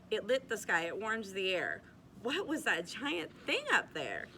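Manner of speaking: lilting read-aloud storybook voice; cosy bedtime storyteller, rhythmic; story narration. A narrator's reading. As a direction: read the rhymes to children, bouncing the beat. Reads rhyming picture book aloud.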